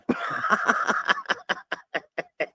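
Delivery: wheezy, breathless laugh; helpless laughter. Real, not acted. Host laughing at own impression, helpless laughter. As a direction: crack up at your own joke.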